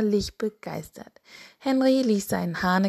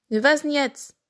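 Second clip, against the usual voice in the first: a slightly raised snail voice; lightly voiced snail; 7 semitones higher, 4 dB louder than usual. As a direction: ask higher, as a lightly played snail. Snail asks impatiently in the tale.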